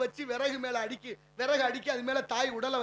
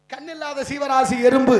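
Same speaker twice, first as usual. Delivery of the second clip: much louder, emphatic declaration; preacher's ringing volume; loud proclamation; 12 dB louder than usual. Real, not acted. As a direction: belt it out to drive the point home. Preacher drives home his point.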